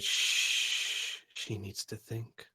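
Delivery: whispered shh, then soft; hushing. Acted. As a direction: hush him gently, menacing. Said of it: "And he said".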